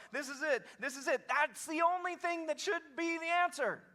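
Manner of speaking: high, loud, impassioned plea; fervent outburst. Acted. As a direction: cry it out with desperate eagerness. Acts the answer he should give.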